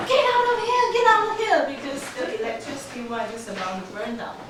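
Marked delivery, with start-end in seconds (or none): 0.1-1.6 high, loud warning yell; urgent shouting. Retold or imitated. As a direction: shout everyone away from danger. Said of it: "I heard my husband shouting".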